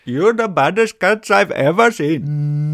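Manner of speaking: loud mock Indian accent scolding. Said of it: "in an Indian voice".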